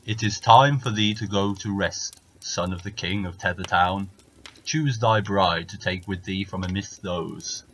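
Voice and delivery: heavy giant giving orders. Acted. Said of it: "and the giant said".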